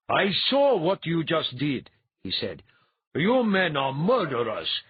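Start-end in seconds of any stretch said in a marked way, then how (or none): 0.1-1.9 loud, slow, high indignant priest; indignant accusation. A narrator's reading. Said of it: "eyes were flashing indignantly". 3.1-4.8 loud, slow, high accusation; indignant priest. A narrator's reading. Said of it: "eyes were flashing indignantly".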